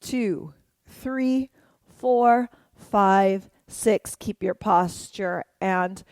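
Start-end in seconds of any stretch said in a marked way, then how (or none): 0.0-4.1 dance count. Shown as a demonstration. Counting along to dance moves.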